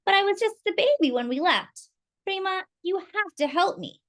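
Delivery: put-on high, pleading child voice; exclaiming. Lola begs her cousin for help, exclaiming. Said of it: "Lola exclaimed".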